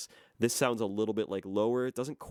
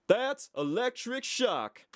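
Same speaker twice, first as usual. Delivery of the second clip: his own voice, higher and slow; unshifted own voice; 13 semitones higher, much slower than usual. Shown as a demonstration. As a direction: say it in your natural voice, drawn out. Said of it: "That's me".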